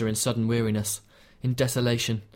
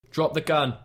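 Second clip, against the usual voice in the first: higher-pitched police order, held in volume; shouted command; 4 semitones higher than usual. A narrator's reading. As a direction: call out a firm order to an armed man. Police shouting at armed man.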